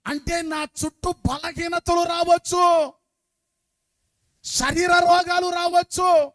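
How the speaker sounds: loud, high, slow preaching shout; full-force preaching. Acted. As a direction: thunder it out to the congregation. (గట్టిగా అరుస్తూ బోధించడం)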